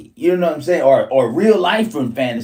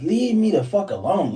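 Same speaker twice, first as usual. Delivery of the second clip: ordinary talk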